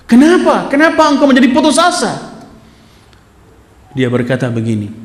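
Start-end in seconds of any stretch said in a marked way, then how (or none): 0.1-2.4 incredulous rebuke. Acted. Friend confronting the despairing man, high incredulous rebuke. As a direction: shake him out of his despair with disbelief.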